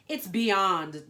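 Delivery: loud, emphatic diva verdict; fashion declaration. Acted. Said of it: "declared Panda".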